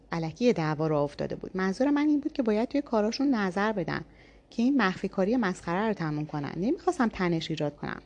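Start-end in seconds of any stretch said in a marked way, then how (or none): none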